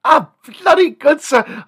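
high, loud British exclamation; excited, high-pitched British gentleman; British accent. Acted. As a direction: cry out in plummy British delight. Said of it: "I just can't talk like that anymore".

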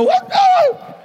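very high, loud startled squeal; hammed-up screech of alarm; horrified mock shriek. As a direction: shriek as if waking to a shock. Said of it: "roll over in the morning and go".

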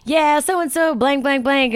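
lightly voiced sassy girl; a hint of a mouthy kid. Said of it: "She's like".

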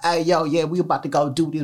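usual speaking voice

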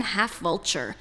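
usual speaking voice

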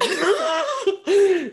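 loud high burst of laughter; big laugh. Real, not acted. Laughs at the co-host.

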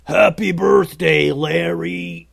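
drawn-out troll holler, loud at the start; slow, stretched-out party shout. A narrator's reading. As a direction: holler it like a whole crowd of trolls. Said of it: "The trolls all hollered".

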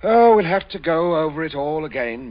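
loud, impatient burst; exasperated. Acted. Father losing patience with his daughter. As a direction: boom it out in frustration.

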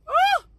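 quick high "woo"; high whoop. Real, not acted. Preacher punctuating a point, high whoop. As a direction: let a short whoop slip out.

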